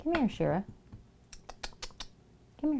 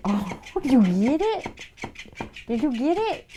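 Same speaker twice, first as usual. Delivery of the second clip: excited pet talk; 8 semitones higher, 7 dB louder, slower than usual. Real, not acted. Owner teasing her cat with the toy fish, high and loud.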